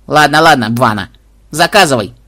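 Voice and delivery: the bird gives up with bad grace; grudging parrot. A narrator's reading. Попугай сдаётся. (попугай нехотя сдаётся, громко)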